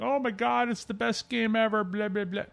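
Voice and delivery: high, mocking fanboy gush; sarcastic mimic of gushing fans. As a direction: ham up the overexcited fan.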